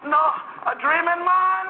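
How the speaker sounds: very high mock Haitian accent; high-pitched Haitian granny in wonder; Haitian old lady. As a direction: imitate a disbelieving old Haitian woman. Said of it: "she could hardly speak English".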